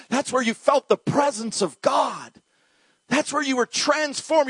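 high, emphatic fervour; fervent, ringing proclamation; impassioned preaching. Real, not acted. Preacher proclaims love and God's presence.

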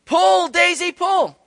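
high, loud yell to the horse; a farmer's shout across the field. Retold or imitated. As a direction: call the horse hard. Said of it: "he yelled out again".

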